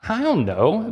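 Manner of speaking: "I don't know" lifted high with a rising, helpless tune; mock helpless shrug. Shown as a demonstration. Preacher voicing the healed man's answer, high helpless rise. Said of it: "I don't know." That's his first remark".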